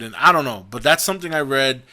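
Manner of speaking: normal talking voice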